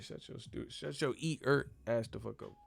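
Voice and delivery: usual speaking voice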